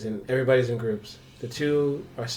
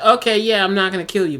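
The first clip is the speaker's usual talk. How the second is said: louder, higher mock-casual quote; mock reassurance; 7 semitones higher, 7 dB louder than usual. Player voicing himself sparing a victim, mock reassurance. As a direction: toss it out as a loud pretend promise.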